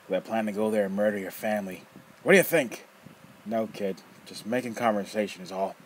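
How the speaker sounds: loud, rough, mocking old man; teasing old rogue. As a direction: toy with the kid, loud and gleeful. Nefer mocking.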